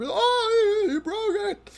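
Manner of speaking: put-on high whiny child cry; upset child. Narrator voicing Galadriel upset at the bullies. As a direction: wail like a kid whose toy broke.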